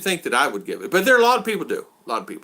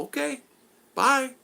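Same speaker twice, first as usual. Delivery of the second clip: very high, light okay-bye; sing-song okay; 12 semitones higher, slower than usual. Retold or imitated. Replying to the guy's updates, high mock chirp. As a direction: brush him off in a high chirpy voice. Mocking his own replies to texter.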